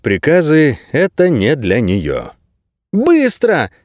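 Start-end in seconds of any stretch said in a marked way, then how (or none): 2.9-3.7 high-pitched urgent command; barked order. A narrator's reading. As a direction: snap the word out as an order. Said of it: "рявкнула она".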